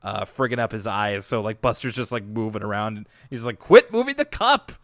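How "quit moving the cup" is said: bratty yell. Retold or imitated. Old Binky snapping at Buster.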